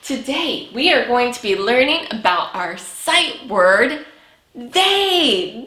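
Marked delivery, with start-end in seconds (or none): none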